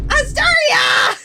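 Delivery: drawn-out high shriek of a name; loud, slow, high scolding shout; mock-outraged scream. Real, not acted. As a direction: yell the name in comic exasperation. Mock-scolds viewer before newcomers.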